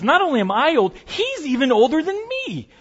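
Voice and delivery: mock old woman. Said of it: "And she goes".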